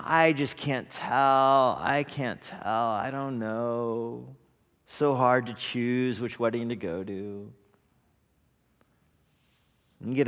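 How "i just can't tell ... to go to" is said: dithering whine. Retold or imitated. Indecisive guest dithers, trailing off.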